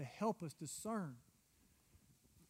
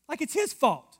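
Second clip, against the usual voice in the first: loud, high-pitched mocking disbelief; mock indignation; 9 semitones higher, 11 dB louder than usual. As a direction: throw it out as a scoffing protest. Mocking people who blame God.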